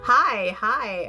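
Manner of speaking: usual speaking voice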